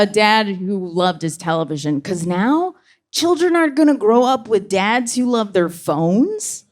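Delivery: normal talking voice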